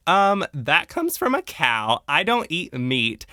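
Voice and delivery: drawn-out, pointed correction in a quoted voice; imitated indignant objection. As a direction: spell it out, mock-appalled. Acts Lizzie objecting to leather jacket.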